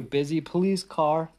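ordinary delivery, nothing marked

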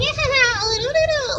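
high cackling laugh; cackling imitation. Imitating the dude's cackle, high laugh. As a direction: cackle like that dude. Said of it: "like that".

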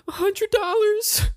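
very high, slow squeak; distressed streamer. Acted. Streamer telling police what he shoves in, distressed. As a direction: wail the sum in a hammed-up falsetto.

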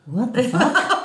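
slow, high imitating baffled friends; stunned drawn-out. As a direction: drag it out in disbelief. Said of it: "all his friends just went".

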